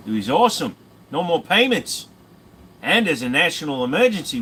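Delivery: put-on over-the-top Aussie accent. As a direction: play a brash Aussie bloke, mock Australian accent. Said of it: "Aussie accent".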